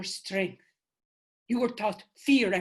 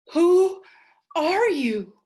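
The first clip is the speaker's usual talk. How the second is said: loud, high alarmed question; startled cry; 8 semitones higher, 8 dB louder, slower than usual. Acted. Selena confronting the strange spirit, startled and loud. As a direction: blurt it out in shock.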